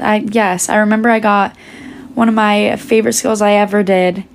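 usual speaking voice

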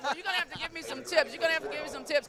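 bubbling over with delight; excited. Real, not acted. Coach thrilled to swap grandparent tips, excited.

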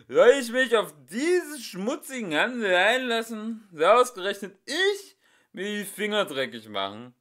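high loud theatrical outrage; dramatic indignation. Acted. Drunk singer proclaims his purity, high theatrical outrage. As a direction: ham up the wounded artist.

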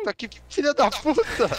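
angry shout. Real, not acted. Teammate shot, furious outburst.

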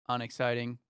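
usual speaking voice

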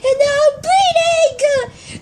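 high bawl into slow, drawn-out words; pretend wailing. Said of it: "don't cry".

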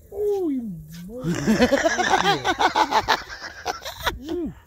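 very high, loud, drawn-out curse; panicked squeal. Real, not acted. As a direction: squeal the curse at the jolting lift. Cursing the lift as it jolts.

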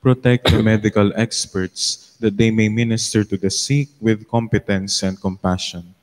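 steady, solemn prayer reading. Acted. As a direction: lead the congregation in prayer. Leads a prayer at Mass.

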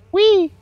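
high, loud squeal of "whee"; playful whee. Real, not acted. Playful whoop during game.

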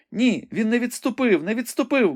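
high-pitched frightened maiden at the window; young woman Rebecca, anxious. A narrator's reading. Rebecca tells the wounded knight what she sees, high and anxious.

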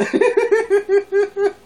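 loud high burst of laughter. Real, not acted. Vlogger laughing at his own cheeky joke, loud and high.

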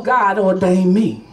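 normal talking voice

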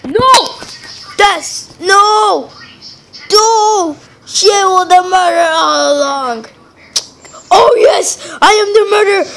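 loud, high gamer outburst; big high-pitched yell; excited shouting. Real, not acted. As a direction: shout it out at the top of your voice. Shouting out during game reveal.

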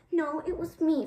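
firm declaration. A narrator's reading. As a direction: insist boldly. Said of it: "Bristol declared".

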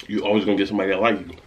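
chewing while talking; talking between bites; mouth full. Real, not acted. Seen 2: bite taken at -2.0, mouth working and lips pressed through 3.8.

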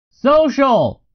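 high-pitched, projected cry; yelled. A narrator's reading. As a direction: yell it out to a noisy room. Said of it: "yelled a phrase".

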